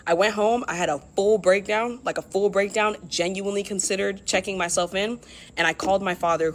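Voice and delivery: ordinary delivery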